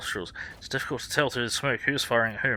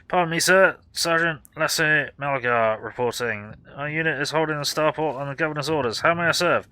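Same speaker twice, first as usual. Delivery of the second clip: barking warden sergeant; 4 semitones higher than usual. Acted. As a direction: play a harried frontline sergeant yelling at newcomers. Sergeant voice again.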